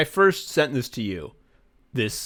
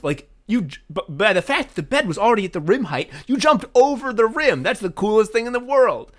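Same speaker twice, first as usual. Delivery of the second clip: high-pitched, loud, escalating enthusiasm; excited rant; 6 semitones higher, 4 dB louder than usual. Real, not acted. Friend raving about jumping over the rim, excited rant. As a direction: get carried away, pitch leaping up.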